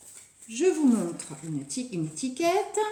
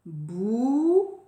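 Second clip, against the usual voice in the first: "Bouton" with bou long and rising, long pause, ton; syllable counting. Shown as a demonstration. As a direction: count out "Bouton" for children to join in. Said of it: "Allez, vous faites avec moi".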